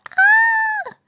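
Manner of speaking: sudden high-pitched scream; high shriek. Acted. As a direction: let out a short piercing shriek. Basement flooding alarm.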